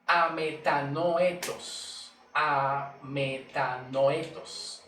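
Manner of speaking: "metanoetos" drawn out very slowly; Greek word demonstration. Shown as a demonstration. Preacher teaching the Greek for repentance, "metanoetos" slowly sounded out. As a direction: sound out "metanoetos" slowly, stretching each syllable. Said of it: "es, se traduce".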